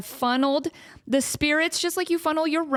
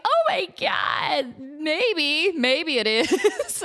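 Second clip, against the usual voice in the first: slow, lightly higher awed whisper of belief; mock awed visitor; 4 semitones higher, much slower than usual. Visitor wondering if the relic is real, mock awe. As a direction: let yourself be half convinced, slowly.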